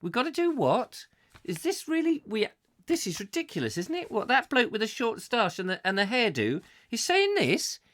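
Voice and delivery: acted disbelief, pitch leaping high; high, protesting mock incredulity; incredulous objection. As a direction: play the bystander who can't believe the order. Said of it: "humanity would go".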